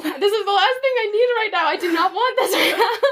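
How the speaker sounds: mock despair, laughing it off; laughing exasperation. Retelling her frustration to a friend, laughing exasperation. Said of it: "Oh my gosh".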